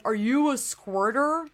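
slow, mocking imitation of a sleazy guy at the bar; imitating the creepy guy. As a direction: drag it out like the creep who asked. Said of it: "He was like".

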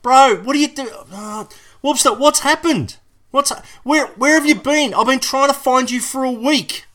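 high, loud, frantic questions. Acted. Host frantically questioning the missing Warbster on the phone. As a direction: panic as if the boss went missing.

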